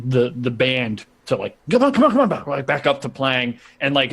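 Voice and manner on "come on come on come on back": high, rushed repeated urging. Acted. Rufus getting the band playing, high rushed urging. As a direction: hurry the band along in a high voice.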